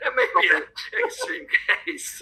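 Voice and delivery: amused by the dog-died joke, laughing as he speaks. Real, not acted. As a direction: talk through real laughter.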